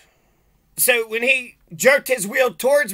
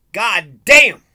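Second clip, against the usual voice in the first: exasperated outburst; 8 dB louder than usual. Retold or imitated. Exasperated outburst ending protest.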